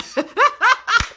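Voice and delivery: high, loud burst of laughter; hearty laugh. Real, not acted. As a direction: laugh out loud at your own line. Hearty laugh at own line.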